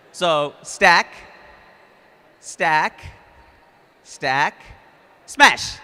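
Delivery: shouting stack, stack, stack, then a big smash; shouted game calls. Real, not acted. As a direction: call the game, build to the smash. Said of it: "when I say smash".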